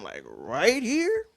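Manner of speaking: right here drawn out high and slow; stress on right here. Shown as a demonstration. Recreating his flirty scene line, stressing "right here". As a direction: lean on "right here" slowly rising. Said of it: "and so I'm emphasizing".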